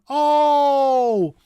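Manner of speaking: long, loud, high O; exaggerated Dutch double-o O; wide-open O. Shown as a demonstration. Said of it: "It's wide open".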